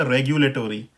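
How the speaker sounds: रेगुलेटरी as reg-yu-lay-tor-ee; American pronunciation. Shown as a demonstration. Teacher names the American version, American pronunciation. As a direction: sound the o before r in रेगुलेटरी. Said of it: "American pronunciation में हो जाएगा".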